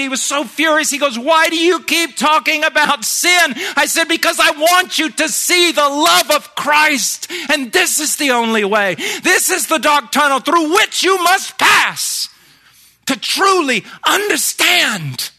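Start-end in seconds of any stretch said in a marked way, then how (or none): 1.2-3.5 a louder, higher furious question; mock angry reporter. A hammed-up furious reporter demanding an answer. 4.1-15.3 impassioned reply. Retold or imitated. Preacher answering the reporter, earnest and near tears.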